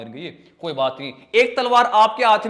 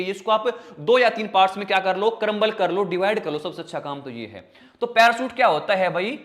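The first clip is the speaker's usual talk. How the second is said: normal talking voice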